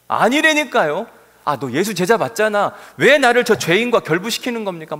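loud, high furious denial; angry denial. Acted. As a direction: shout him down in exasperation. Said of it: "막 화를 내고 있었을 때". (화를 내며 높고 크게 부인하는 베드로)

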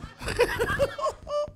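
high-pitched gleeful cackle; tickled by your own joke; high laugh. Real, not acted.

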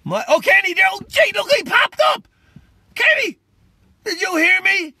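spotting Kenny pop up in the chat, overjoyed; excited. Real, not acted. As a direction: call out to Kenny, thrilled. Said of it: "I'm sorry I get excited".

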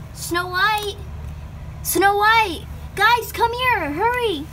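high, loud alarmed calling. Acted. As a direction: shout for her in a panic.

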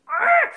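short, high, loud squeal; high growly squeal of delight; excited squeal. Real, not acted. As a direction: burst of happy overflow with no words. Overjoyed after the guest call.

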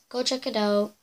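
ordinary talk, nothing marked

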